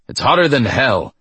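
irritated growl, a touch of heat. A narrator's reading. Rider burnt by hot metal, growling. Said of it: "he growled".

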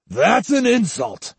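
high, indignant shout. A narrator's reading. Samurai thrusting his chest at the younger man, shouting. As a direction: shout it as an outraged retort. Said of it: "and shouted".

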